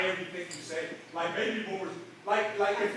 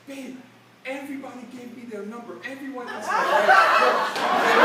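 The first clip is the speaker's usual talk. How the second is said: excited wife; 3 semitones higher, 7 dB louder than usual. Retold or imitated. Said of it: "she's like".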